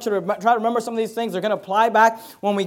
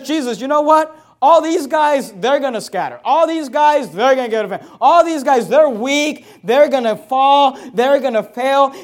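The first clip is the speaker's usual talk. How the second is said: higher and louder, belittling the others; proud boast; 4 semitones higher, 6 dB louder than usual. Acted. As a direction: brag that everyone else is weak. Peter boasting others will fail.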